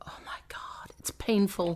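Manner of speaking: hushed aside, no voice; whisper. Real, not acted. Complaining to friends in a whisper. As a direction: lean in and whisper it. Whispering about painful British shows.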